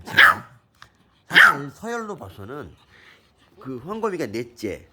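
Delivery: ordinary talk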